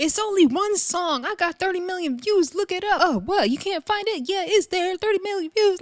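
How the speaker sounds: high-pitched mimicked boasting; mock bragging. Retelling [name] defending her song on stage, high mock bragging. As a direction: push it up high, insisting on every claim.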